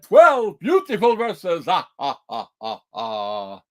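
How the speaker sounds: a hint of a counting vampire. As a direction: play a Count-style vampire. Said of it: "Vampire went".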